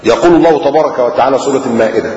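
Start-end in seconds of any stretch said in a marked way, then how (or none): none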